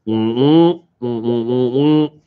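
muffled wah-wah trombone voice; Charlie Brown's teacher. Retold or imitated. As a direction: hum like the Peanuts teacher. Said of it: "I did a good impression".

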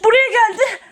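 high alarmed warning, playing scared; mock alarm. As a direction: warn in pretend panic. Pursuer arrives at hiding place.

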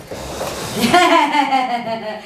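a real laugh breaking out; burst of laughter. Real, not acted. Laughing after building her table.

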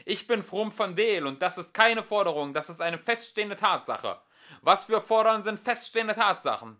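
high, loud indignant ranting. A narrator's reading. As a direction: hammer each point home. Philosopher ranting loudly.

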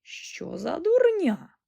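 high, slow indignant exclamation; snapped retort. A narrator's reading. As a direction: dismiss it crossly, stretching the words. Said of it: "відрізала вона".

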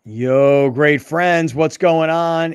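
loud, stretched-out radio greeting; show opener. Real, not acted. Host greeting listeners back for the second half, loud drawn-out hype. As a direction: hail the audience with drawn-out energy.

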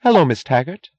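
high, bright hello with an edge; sharp, bright greeting. A narrator's reading. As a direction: greet a rival pointedly. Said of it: "she said sharply, her voice loud and bright".

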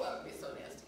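whispered disgust. Retold or imitated. Disgust at being licked.

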